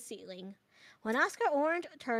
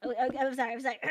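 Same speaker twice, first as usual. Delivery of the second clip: ordinary delivery, nothing marked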